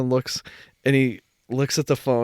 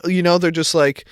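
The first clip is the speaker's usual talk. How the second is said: ordinary talk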